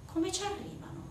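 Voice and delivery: ordinary delivery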